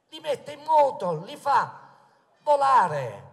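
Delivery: loud rally oratory, projected to the crowd; booming balcony speech. Acted. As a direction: proclaim it from the balcony.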